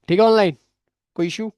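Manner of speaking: loud, high call across the room. Real, not acted. Teacher asking online students about issues, loud call.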